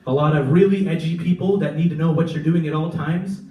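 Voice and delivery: normal talking voice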